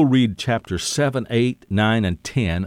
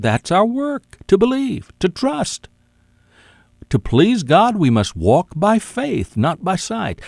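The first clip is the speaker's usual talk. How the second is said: fiery preaching; 10 semitones higher than usual. Acted. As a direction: preach it hard, soaring up. Preaching rises to full force.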